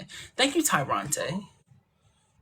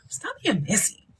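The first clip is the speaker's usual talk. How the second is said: whispered scolding; 5 semitones higher than usual. Real, not acted. Scolding a chat commenter.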